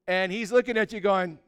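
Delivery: normal talking voice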